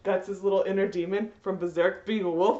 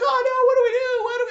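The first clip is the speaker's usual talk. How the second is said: high, fast, loud mock panic; mock panicked friends; 13 semitones higher, 6 dB louder, faster than usual. Friends panic over the dying hero, high and fast mock squeal. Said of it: "they're like".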